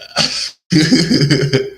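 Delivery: bright burst of laughter; loud high laugh. Real, not acted. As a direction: laugh hard at the idea. Laughing after punchline.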